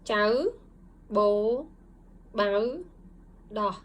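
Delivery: slow Vietnamese syllable drill; Vietnamese syllables read out. Shown as a demonstration. Teacher modeling Vietnamese syllables for students, each one clear and separate. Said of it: "And please read it".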